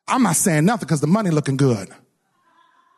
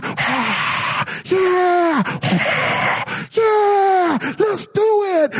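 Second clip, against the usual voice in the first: hyped cheering; 11 semitones higher, much slower than usual. Retold or imitated. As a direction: imitate a hyped-up sales crowd. Retold hyped crowd noise.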